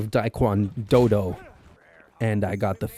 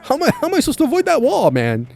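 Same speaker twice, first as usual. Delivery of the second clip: high, fast, exasperated; panicked gamer rant; frantic disbelief; 3 dB louder, much faster than usual. Real, not acted. Frantic at game obstacle.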